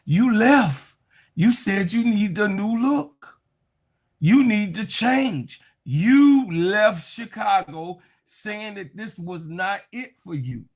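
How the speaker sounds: jab each accusation at her slowly; calling her out. Real, not acted. Calling out player who left.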